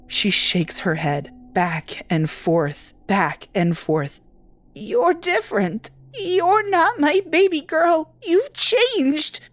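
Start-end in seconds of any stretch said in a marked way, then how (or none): 4.7-9.4 very high, thin frightened voice; panicked elderly woman; terrified old mother. Acted. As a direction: recoil from a daughter she no longer knows.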